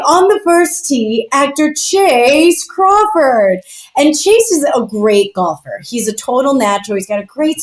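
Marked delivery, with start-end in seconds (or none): 0.1-1.3 high, drawn-out tee announcer call; first-tee announcer. Acted. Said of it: "and they're like". 1.3-3.6 stretched-out announcer introduction, pitched up; first-tee announcer. Acted. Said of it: "and they're like".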